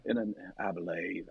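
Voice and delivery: low, soft Texas murmur on "I believe"; McConaughey impression. Shown as a demonstration. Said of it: "I always start with".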